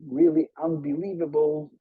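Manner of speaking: ordinary talk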